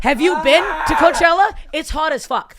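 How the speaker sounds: high, loud rhetorical question; excited. Real, not acted. Asking her friends about Coachella heat, high and loud. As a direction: ask it with big indignant energy.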